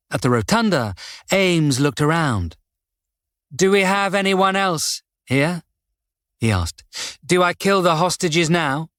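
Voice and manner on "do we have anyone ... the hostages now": a hint of a higher, cool villain; toying with them, lightly menacing; lightly voiced calm killer. A narrator's reading. Ames villain voice.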